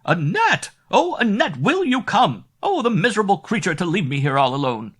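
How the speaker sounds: high, loud wailing call; crying out from below. Acted. The mistress wailing up the stairs for her maid. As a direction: wail for her from downstairs, aggrieved. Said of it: "the cries below continued".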